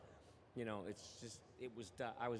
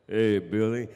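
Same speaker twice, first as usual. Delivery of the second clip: loud greeting; 2 semitones lower, 16 dB louder, slower than usual. Retold or imitated. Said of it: "And he goes".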